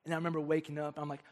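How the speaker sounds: ordinary delivery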